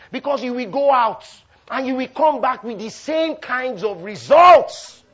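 loud, high, stretched-out declaration; full-force preaching. Real, not acted. Preacher warning the congregation about their results, full-force preaching. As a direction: drive it home to the crowd.

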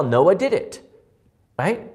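usual speaking voice

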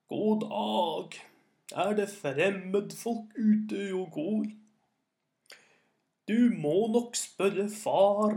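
put-on very slow, strained old-man voice; ancient old voice. A narrator's reading. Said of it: "med en stemme som var så gammel".

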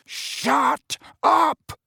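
high, loud furious roar. A narrator's reading. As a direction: bellow it at the singing kids. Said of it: "roared Missus Weasley".

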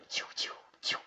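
whispered web-shooting noise; web sound effect. Retold or imitated.

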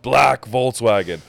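slow, insistent broken-English imitation. Driver insisting to the caller, slow loud broken English. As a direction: repeat it slowly and loudly in imitated broken English. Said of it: "super broken English".

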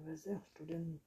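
plain reading voice

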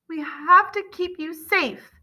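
slow, high-pitched plea; anguished, drawn-out reassurance; pleading mother. A narrator's reading. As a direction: implore him to understand. Mother pleads through locked door.

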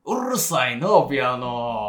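loud, drawn-out Kansai complaint; mock-scold loudly in Kansai dialect; angry Kansai dialect. Telling off a neighbour about piano noise, irritated Kansai dialect. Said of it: "関西弁で。".

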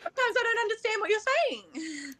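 high-pitched imitation of a confused listener; imitating baffled contestants. Pageant contestant retelling others' complaint about her speech, high mock bafflement. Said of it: "everyone actually said to me".

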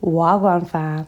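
slow posh-sounding London roadman greeting; posh roadman accent. Pretend. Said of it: "the most poshest Wagwan fam I've ever heard".

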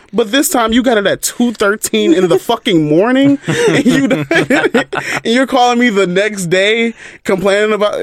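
laughing while ranting. Real, not acted. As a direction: rant through the laughter. Laughing while ranting about caller.

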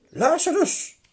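verrast uitgeroepen, veel hoger; Lazarus!, vol ongeloof; verbaasd. Acted. Rijke man ziet Lazarus. (de rijke man ziet tot zijn verbazing Lazarus)

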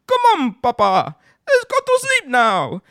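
put-on high child's take on a Jamaican accent; son mimicking Jamaican. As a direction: copy Dad's mock Jamaican in a high voice. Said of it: "started being monkey see, monkey do".

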